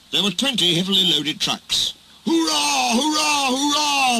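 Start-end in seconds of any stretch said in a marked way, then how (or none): 2.3-4.2 very high, long drawn-out cheers; gleeful cheering. A narrator's reading. Runaway trucks celebrating their breakaway, gleeful high cheering. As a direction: cheer wildly in a high character voice. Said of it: "they laughed".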